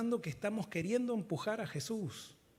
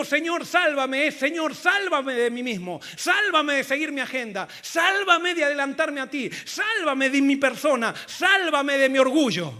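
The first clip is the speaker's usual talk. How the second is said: loud, high, drawn-out cries to God; full-force preacher's prayer; impassioned plea; 5 semitones higher, 10 dB louder than usual. Acted. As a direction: cry out for rescue, stretching each sálvame. Preacher acts out pleading prayer.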